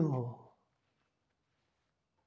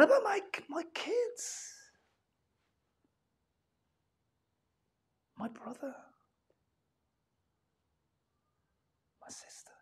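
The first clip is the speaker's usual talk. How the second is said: near-whispered, much softer, trailing away; hushed worry; 13 semitones higher, 9 dB quieter than usual. Acted. Preacher to congregation, hushed fearful whisper. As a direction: let the worry fall to a breath.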